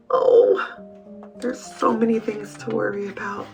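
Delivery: soft, deflated lament; overwhelmed. A narrator's reading. Wimberly with so many worries, softly overwhelmed. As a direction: let the panic drop to a quiet sigh.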